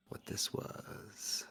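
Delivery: slow breathy whisper for drama. Pretend. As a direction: whisper it like a spooky reveal. Dramatic whispered reveal of photo.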